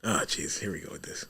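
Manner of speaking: whispered, resigned groan; whispered aside. Real, not acted. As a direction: whisper it under your breath. Groaning aside at friend's rant.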